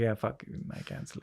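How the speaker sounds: breathy whispered aside, almost no voice; whispered admission. Retold or imitated. Retelling admitting he cancels too, whispered. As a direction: mutter it under your breath as if confessing. Admitting fault after partner laughs.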